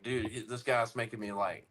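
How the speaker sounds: soft, low, bored grumble; drowsy boredom. Retold or imitated. Listening to a dull talker, drowsy and bored. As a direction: say it quietly, as if dozing off. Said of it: "sometimes I'll go to sleep".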